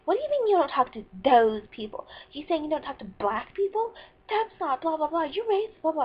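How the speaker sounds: loud imitation of an outraged classmate; mock indignant accusation. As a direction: accuse loudly, raising the pitch as it builds. Acting out classmate's angry accusation.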